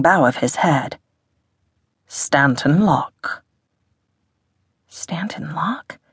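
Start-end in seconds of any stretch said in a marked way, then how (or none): 2.1-3.4 slow posh English self-introduction; posh English accent. A narrator's reading. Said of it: "in a posh English accent". 4.9-6.0 soft, slow, rising question; hushed wondering question. A narrator's reading. Hiss wonders at the name.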